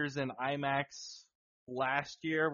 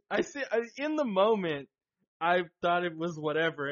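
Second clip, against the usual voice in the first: normal talking voice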